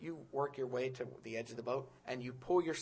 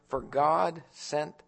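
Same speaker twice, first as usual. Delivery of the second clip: slow quote; 2 semitones lower, 7 dB louder, much slower than usual. Shown as a demonstration. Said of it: "Ever read that verse".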